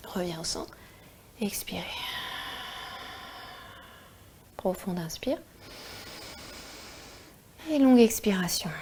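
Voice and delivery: soft whispered breathing guidance; guided meditation. Acted.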